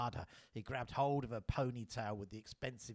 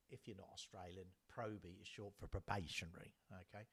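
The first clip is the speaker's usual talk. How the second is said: softer explanatory aside; quiet aside; 2 semitones lower, 9 dB quieter than usual. Real, not acted. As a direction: drop the voice to explain. Aside to listeners.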